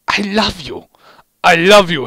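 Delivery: loud, high repeated declaration; hammed-up love. A narrator's reading. Heavy kissing Medic, loud high hammy declaration. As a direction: overact the I love you.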